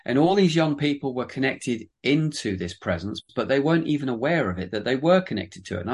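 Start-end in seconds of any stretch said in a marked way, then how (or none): none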